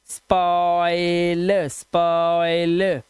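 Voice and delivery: स्पॉइल stretched very slowly on the oi; स्पॉइल sounded out at a crawl; drawn-out oi sound. Shown as a demonstration. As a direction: draw out the vowel glide in स्पॉइल. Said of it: "ऑई करना है".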